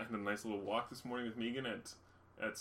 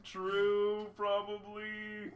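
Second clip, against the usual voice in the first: very slow, stretched words; drawn-out hedge; 8 semitones higher, much slower than usual. Pretend. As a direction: drag each word out. Drawn-out hedging.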